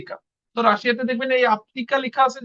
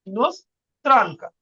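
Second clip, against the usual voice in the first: ইনোস্ত্রানকা drawn out slowly in two parts; slow syllable-split demo; slower than usual. Shown as a demonstration. Teacher repeating the women's form for learners, ইনোস্ত্রানকা slow and split. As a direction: break ইনোস্ত্রানকা slowly after "inos".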